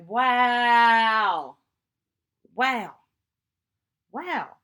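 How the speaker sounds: long loud drawn-out wow, then shorter ones; astonished wows, fading after pauses; stunned wow. Retold or imitated. Retold reaction to friend's photo.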